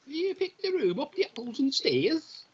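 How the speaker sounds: high, drawn-out mock Cockney; slow, squeaky-high stab at Cockney; attempted English accent. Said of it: "Why can't I do an English accent?".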